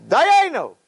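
loud high Dayenu shout; family shout. Retold or imitated. Family at the seder shouting Dayenu loud. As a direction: shout Dayenu like a whole table. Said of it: "the whole family screams".

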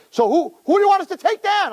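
high, eager mock tough guy; tipsy bravado. Purim students asking the rebbe for a target, high-pitched bravado. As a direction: offer muscle in a high, worked-up voice. Purim drunks offer to fight.

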